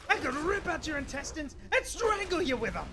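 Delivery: high, loud manic glee; gleefully psychotic imitation; hammed-up cheerful threat; mimicking game voice. Repeating the game's plant line.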